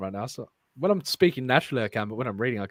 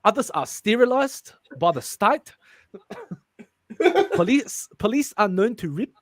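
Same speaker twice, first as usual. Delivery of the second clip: put-on higher, botched South African accent; 5 semitones higher than usual. Reader failing at the script, South African accent. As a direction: attempt a South African accent that keeps slipping. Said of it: "I just fucking sound fully sick".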